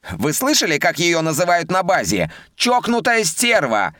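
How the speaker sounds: high, outraged officer protest; indignant subordinates. A narrator's reading. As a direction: pile on complaints, high and offended. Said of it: "возмутились другие подчинённые".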